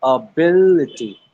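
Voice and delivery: "Ability" said slowly, each syllable clear; slow model word. Shown as a demonstration. Teacher models the whole word slowly for the students. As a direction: draw "Ability" out slowly for the class. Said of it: "I would do that one more time".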